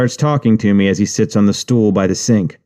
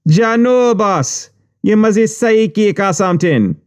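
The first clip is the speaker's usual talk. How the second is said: very high Jamaican voice; impressed high Jamaican patois; Jamaican accent; 12 semitones higher than usual. Retold or imitated. Juice maker voiced.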